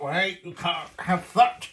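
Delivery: loud put-on voice of an objecting Valencian; half-hearted impression of a local objecting; mock objection. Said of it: "they didn't talk like that".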